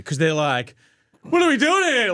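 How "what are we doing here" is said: higher, louder child complaint, acted out; complaining kids.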